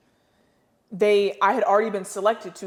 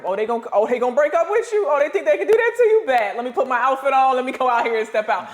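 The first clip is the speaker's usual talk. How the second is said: put-on much higher and louder, fast and laughing, a defiant girlfriend just dumped; sassy breakup voice; 6 semitones higher, 5 dB louder than usual. Player acts the dumped girl getting dressed up to step out, high sassy laughing voice. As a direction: play the scorned girlfriend gearing up to show out.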